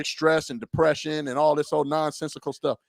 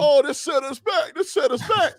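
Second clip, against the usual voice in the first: mocking whine; 9 semitones higher than usual. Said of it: "This guy talking about".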